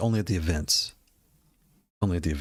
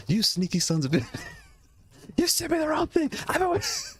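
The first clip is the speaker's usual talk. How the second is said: much higher, hammed-up furious rant; imagined angry email. Buyer sent the wrong stick, writing an angry email: high, hammed-up outrage. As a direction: squeal out a mock outraged complaint through laughter. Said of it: "I could just imagine the email".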